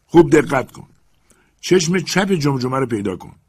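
Legrand calling up. Acted. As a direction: project up into the tree, firm and eager. Legrand commands.

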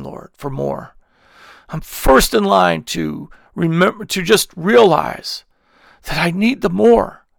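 heartfelt pleading prayer, slow in places; earnest prayer. Acted. Preacher praying aloud over his online followers, fervent.

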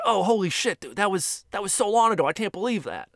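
high-pitched surprised comedian reacting to a fan; Bill Burr. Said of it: "And he's like".

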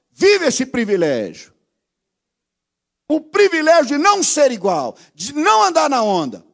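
high, loud, shouted exhortation; fiery pulpit shout; full-force preaching. Acted. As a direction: preach it at the top of your voice to the youth.

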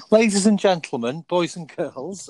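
booming MC intro to the crowd; grand entrance announcement. Acted. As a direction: announce him like a ringmaster. Presenter announcing arrival at checkpoint.

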